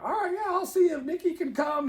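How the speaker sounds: lifted, mocking boyfriend imitation; high mock boyfriend voice. Boyfriend dodging his friend's invite, high mock voice. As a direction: pitch him up and act a bit innocent. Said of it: "And he's like".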